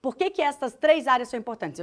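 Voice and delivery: ordinary talk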